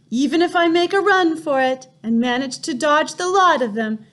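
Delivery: high, frantic inner voice; terrified boy thinking aloud; panicked boy. A narrator's reading. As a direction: panic with nowhere to run. Boy panicking inside the witch room.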